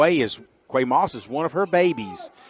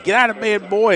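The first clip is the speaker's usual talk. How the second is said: higher-pitched mock mama yell; 9 semitones higher, 4 dB louder than usual. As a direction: holler it like a mother rousing her son. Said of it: "like his mama yelling at him".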